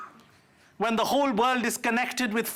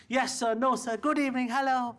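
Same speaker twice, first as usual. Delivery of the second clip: lightly voiced polite servant, eager to please; a hint of over-polite deference; obedient colonial subject. Indians answering British masters, mildly mock deferential. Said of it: "did everything that the British people told us".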